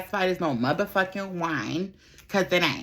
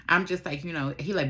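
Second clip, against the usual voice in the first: ordinary talk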